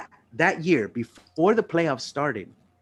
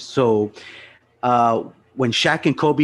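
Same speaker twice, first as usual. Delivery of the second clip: ordinary talk, nothing marked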